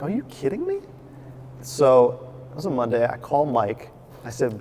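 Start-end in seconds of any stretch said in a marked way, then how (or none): 0.0-0.8 incredulous. Retold or imitated.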